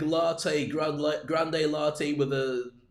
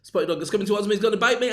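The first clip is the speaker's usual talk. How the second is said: higher, louder, rising alarm; mock panic; 5 semitones higher, 5 dB louder, faster than usual. Tourist as dog comes towards them, mock panic. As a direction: build the mock alarm as it nears.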